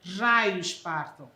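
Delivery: slow, low exasperated grumble; annoyed curse. Shown as a demonstration. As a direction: let the irritation drag out slowly. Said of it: "impaciência ou irritação".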